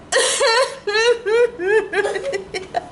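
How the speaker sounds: high laughing sob; cry-laugh over the tack. Real, not acted. Laughing about stepping on tack.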